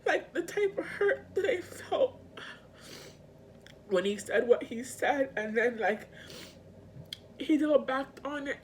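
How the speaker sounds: high-pitched, on the verge of crying; real tearful hurt. Real, not acted. Recalling what he said, tearful and upset. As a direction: let the hurt push the voice up.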